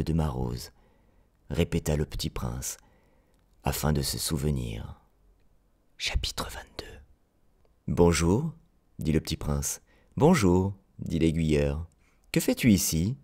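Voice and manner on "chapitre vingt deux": breathy whisper, chapter announcement; whispered chapter title. A narrator's reading. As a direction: whisper the heading like a secret.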